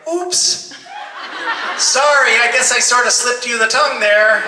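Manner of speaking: high, loud mock-innocent oops; imitating co-star's apology. Acts out co-star's casual apology.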